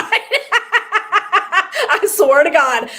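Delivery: drawn-out laugh through the words. Real, not acted. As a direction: laugh it out, very slowly and high. Laughing through an emotional story.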